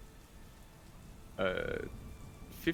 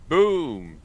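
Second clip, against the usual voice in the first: excited boom; 8 semitones higher, 11 dB louder than usual. Real, not acted. Excited damage call.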